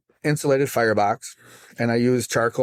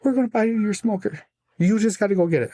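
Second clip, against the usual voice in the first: higher, gently insistent mother; lightly voiced mom offering a gift; mom's offer; 10 semitones higher than usual. Mom telling her son they will buy the smoker, lightly voiced. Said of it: "And she goes".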